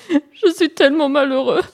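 high, hammed-up weepy hare; tearful. Young hare begins his sad tale to Pan, tearful. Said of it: "se présenta à lui en pleurs".

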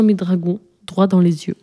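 ordinary delivery, nothing marked

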